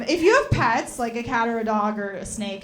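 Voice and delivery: usual speaking voice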